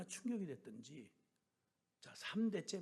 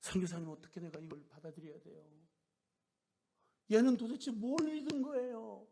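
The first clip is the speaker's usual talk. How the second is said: higher, louder anguished question; grieving plea; 5 semitones higher, 5 dB louder than usual. Retold or imitated. Elder crying to the missionary, grieving. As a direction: plead through grief. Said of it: "우시는데".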